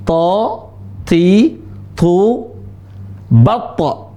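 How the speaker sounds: "ta, ti, tu, batta" each syllable separate; syllable drill. Shown as a demonstration. Teacher showing how the letter ta is read, syllable by syllable. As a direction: drill the letter ta through its vowels. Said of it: "Huruf ta".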